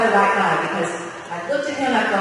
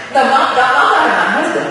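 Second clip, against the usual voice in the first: higher, louder, flustered laughing denial; laughing correction; 5 semitones higher, 6 dB louder than usual. Real, not acted. Correcting the slip to the crowd, laughing. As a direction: laugh while taking it back.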